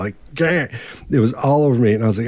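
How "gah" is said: higher-pitched mock "gah"; toddler noise. Imitating a toddler with chocolate all over, silly blurt. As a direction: blurt it like a messy three-year-old. Imitates messy three-year-old.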